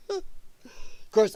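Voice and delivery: normal talking voice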